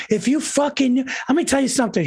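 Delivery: imitating an angry rant about taxes; ranting quote. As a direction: fire up like a furious relative. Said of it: "went on a rant".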